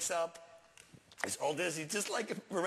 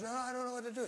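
higher-pitched mock panic; panicking; 7 semitones higher, much faster than usual. Sergeant answering shouted plea to do something, panicking. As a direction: imitate a man in a helpless fluster. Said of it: "He's panicking".

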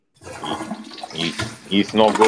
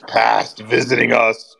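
ordinary talk

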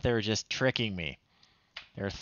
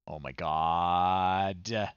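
slow disbelief; 4 semitones lower, much slower than usual. Real, not acted. Reacting to silly game answer.

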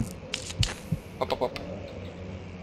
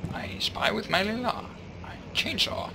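whispering; 6 semitones higher, 8 dB quieter than usual. Real, not acted. Whispered lines.

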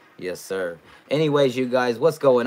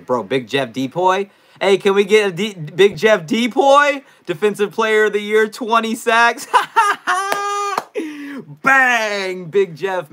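hype shout; 12 semitones higher, 9 dB louder than usual. Real, not acted. Hyping a favorite player on stream.

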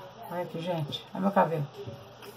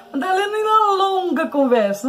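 much higher teasing nag; mock complaining viewer; 11 semitones higher, 3 dB louder than usual. Imitating viewers telling her to cut it short, high teasing nag.

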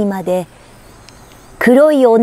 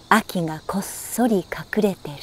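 whisper; 3 semitones lower, 9 dB quieter than usual. A narrator's reading. Autumn hiding secretly in poem.

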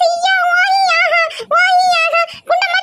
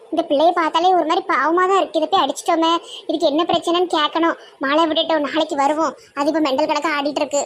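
put-on much lower, grumbling old auntie; low old village woman; 12 semitones lower, faster than usual. Old auntie frets to her sister about the pickle jars, low gruff voice.

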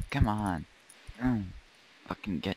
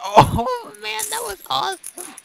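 excited squeal; 6 dB louder than usual. Real, not acted. Laughing after a win.

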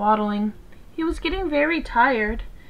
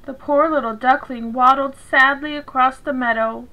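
usual speaking voice

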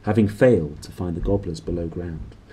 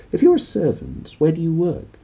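high, probing priest voice; questioning priest; 9 semitones higher than usual. A narrator's reading. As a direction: quiz the boy gently. Priest questions the kitchen boy.

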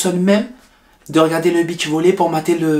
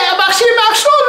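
mocking; 7 dB louder, faster than usual. Mocking name correction.

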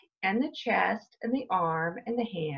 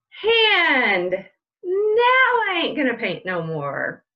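lively read-aloud with slow build-ups and loud punch words; reading to children; 2 semitones higher, 5 dB louder, slower than usual. Acted. Teacher reading a picture book to her class, lively read-aloud. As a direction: stretch the setup, then belt out the body part.

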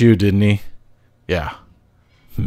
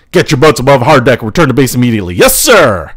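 normal talking voice

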